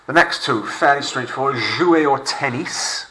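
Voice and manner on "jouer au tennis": Jouer au tennis slowly, tennis the French way; slow French model. Shown as a demonstration. Teacher models phrase for class, slow French. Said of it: "French them up".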